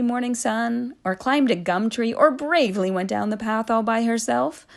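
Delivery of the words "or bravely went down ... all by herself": ordinary delivery, nothing marked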